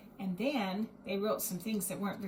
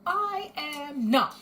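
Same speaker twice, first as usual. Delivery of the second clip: high, slow, loud pouting protest; defiant retort; 6 semitones higher, 7 dB louder, much slower than usual. A narrator's reading. Little girl talks back to Mister Bear, pouting loudly. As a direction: stamp your foot and deny it. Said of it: "replied Edith".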